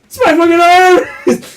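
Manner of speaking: loud, high-pitched mock yell; hammed-up shout of recognition; comic outcry. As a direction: wail it in exaggerated alarm for laughs. Repeats the arm outcry for laughs.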